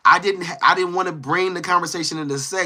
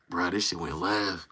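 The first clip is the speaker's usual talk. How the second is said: low, slow, very soft reaction; re-enacted stunned aside under the breath; muttered aside; 11 semitones lower, 12 dB quieter than usual. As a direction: drop right down and drag it out quietly. Voices an aside watching it unfold.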